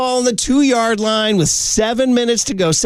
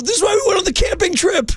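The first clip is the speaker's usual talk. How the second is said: put-on much higher, fired-up kid quarterback; high-pitched mock Mendoza fervor; imitating Mendoza; 12 semitones higher than usual. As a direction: mimic the emotional quarterback, pitched way up. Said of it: "he goes".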